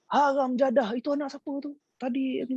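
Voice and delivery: high, cursing demand; mock angry old man. Great-uncle bursts in demanding whose kids, high mock anger. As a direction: scold like an outraged old man. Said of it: "Dia masuk, dia cakap".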